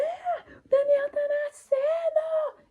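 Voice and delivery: very high, loud slow scream that he is coming; yelling. Retold or imitated. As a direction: scream out the birth. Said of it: "eu gritei, eu dei um berro".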